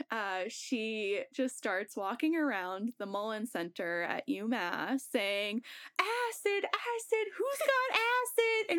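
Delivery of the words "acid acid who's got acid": high, sing-song hawker call; slow, high hammed-up calling; mock calling out. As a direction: imitate a wild friend shouting her wares. Friend calling out for drugs.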